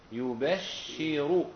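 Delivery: يبشر as yu-bash-shi-ru, drawn out slowly; doubled-sheen reading. Shown as a demonstration. Teacher showing students the reading, يبشر as slow yu-bash-shi-ru. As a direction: recite يبشر with a held double sh. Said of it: "فأصبحت قراءة كم سما نعم".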